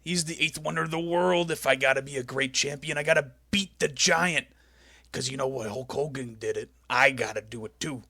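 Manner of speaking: loud growled "Oh, yeah" opening, then a gruff wrestler promo; Macho Man impression. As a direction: channel Macho Man's intensity. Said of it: "So he just goes".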